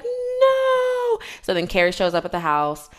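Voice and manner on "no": high, wailing mock sob of "No"; fake-cry it like a heartbroken fan; a hammed-up crying wail; mock sobbing.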